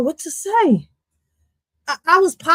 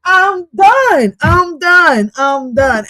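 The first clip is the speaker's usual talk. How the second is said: exasperated, louder at first, then fading; 5 semitones higher, 5 dB louder, much slower than usual. Real, not acted. Fed up with the song, loud then fading. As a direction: say it three times, trailing off. Giving up, repeated.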